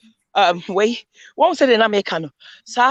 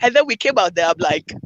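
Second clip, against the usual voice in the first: ordinary talk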